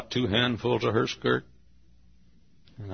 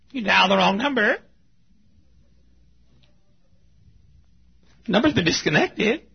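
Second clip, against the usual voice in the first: recorded phone message; 10 semitones higher, 6 dB louder than usual. Acted. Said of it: "Some voice on the telephone says".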